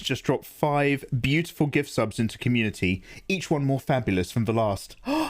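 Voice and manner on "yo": ordinary delivery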